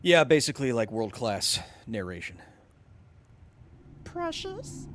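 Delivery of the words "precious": high, thin creature voice; pitched-up Gollum rasp. Acted. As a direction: hiss it like the creature hoarding his treasure. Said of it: "I can also do a screen-accurate Gollum".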